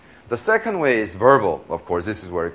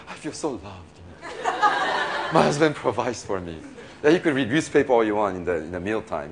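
mock contented wife. Voicing a content provided wife.

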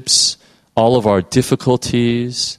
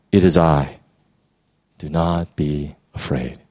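gentle reassurance; 7 semitones lower than usual. Acted. Jesus reassures frightened disciples.

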